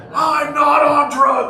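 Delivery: high, drawn-out mock shout; set off, imitated. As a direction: imitate an outraged woman wailing in protest. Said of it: "Oh, that set her off".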